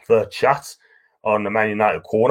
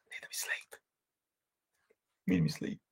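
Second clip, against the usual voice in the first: whisper; 9 semitones higher, 16 dB quieter than usual. Pretend. Sleepy aside about going to bed.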